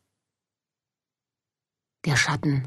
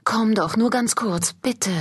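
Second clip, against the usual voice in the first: higher, coaxing plea; pleading; 6 semitones higher than usual. Acted. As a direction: beg him to come just for a moment. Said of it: "ließ Lou nicht locker".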